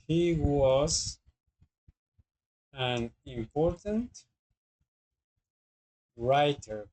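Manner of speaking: slow, careful "an important writer"; teacher finishing the example with a connector, slowly; slow example phrase. Shown as a demonstration. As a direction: lay each word out slowly.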